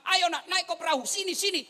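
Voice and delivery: high, loud desperate calling; Noah calling son. Retold or imitated. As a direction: plead with him to climb aboard. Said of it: "ketika Nuh".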